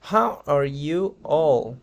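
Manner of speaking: "How... are... you... all", slow, each word apart. Shown as a demonstration. Teacher models the group greeting for learners, slow word by word.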